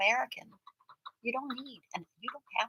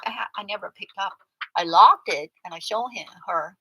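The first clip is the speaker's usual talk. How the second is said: ordinary delivery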